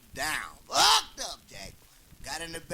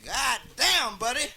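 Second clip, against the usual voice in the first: hammed-up exclamation; 7 semitones higher, 7 dB louder than usual. Drunk husband waking, exclaiming.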